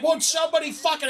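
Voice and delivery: high-pitched exasperated plea; mock yell for anyone to step in; retold screaming. Calling out to the whole building, retold high-pitched yell. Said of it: "I'm screaming in the".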